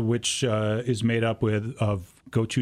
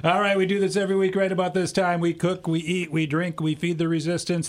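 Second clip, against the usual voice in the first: radio announcer read; 5 semitones higher than usual. Acted.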